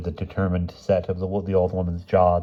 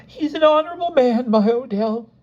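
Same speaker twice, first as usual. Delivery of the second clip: very high-pitched tearful wife; tearful outpouring; 5 dB louder than usual. A narrator's reading. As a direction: plead for your husband in a high voice. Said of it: "words flowed from Missus Smith like".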